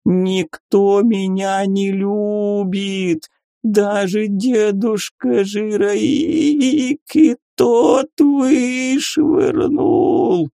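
high, slow blubbering wail; sobbing creature. A narrator's reading. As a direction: wail it through tears like a blob. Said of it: "зарыдала она".

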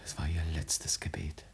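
soft hushed whisper; quiet whisper of grief; grieving whisper. Acted. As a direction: breathe it over her body. Ferdinand over dead Luise.

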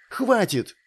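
high sharp cry of Хватит; sharp shout. A narrator's reading. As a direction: snap Хватит out, high and sudden. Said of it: "Вдруг прикрикнула Лейла".

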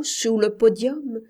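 usual speaking voice

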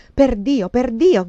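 loud, high, repeated cursing; shouted oath. A narrator's reading. Man cries out as he sips coffee, shouted oath. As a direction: cry it out, twice over. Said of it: "gridò".

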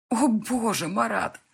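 wife's stunned exclamation. A narrator's reading. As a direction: gasp at his nerve. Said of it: "обалдело произнесла я".

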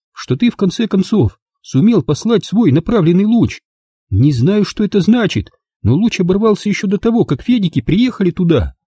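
Angela's voice. A narrator's reading. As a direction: spell it out to him in disbelief. Angela keeps insisting. (Анжела изумлённо настаивает, высоким голосом)

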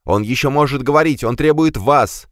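breathless urgent shouts, pitched high; panting out the news; urgent panting report. Acted. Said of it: "задыхаясь, крикнул Адамберг".

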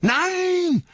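high loud refusal; defiant cry. Acted. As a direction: shout no. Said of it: "sagte Frodo".

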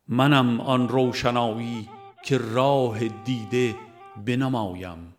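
ordinary talk

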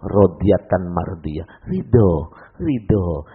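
very slow, soft and low; the inner voice soothing after the pain; second whisper. Retold or imitated. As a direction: draw it out gently like a murmured blessing. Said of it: "Baru ada bisikan kedua".

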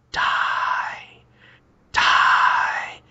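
slow, strained voiceless rasp; eerie imitated ghost voice; ghostly whisper. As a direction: hiss a drawn-out haunting plea. Imitates ghostly voice the character hears.

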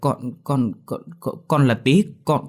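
stuttering, rising in pitch with fear; terrified stammer. A narrator's reading. Tý stammers his name to the drunk father, terrified. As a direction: stammer it out, scared stiff.

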